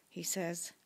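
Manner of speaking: plain reading voice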